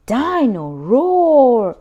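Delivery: slow, loud and stretched, full of delight; excited toddler. Acted. George thrilled by his new toy, slow loud delight. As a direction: savour the name like a treasure.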